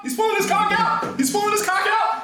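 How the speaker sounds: high, loud hype shouting; excited shouting. Real, not acted. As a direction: yell it over and over, hyped.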